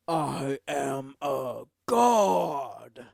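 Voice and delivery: slow, drawn-out godlike boast; lightly voiced villain declaring godhood. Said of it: "when he's like".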